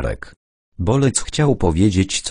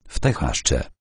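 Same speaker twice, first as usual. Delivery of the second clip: plain reading voice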